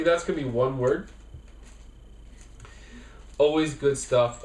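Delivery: upbeat sponsor read. Acted. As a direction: pitch it like a radio spot. Reads sponsor ad.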